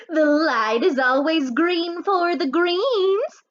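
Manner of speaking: a jolly guard's little pun, drawn out; crossing guard joking with the boys; crossing guard's joke. A narrator's reading. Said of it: "her own little joke".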